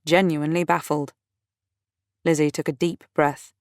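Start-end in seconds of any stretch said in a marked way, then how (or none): none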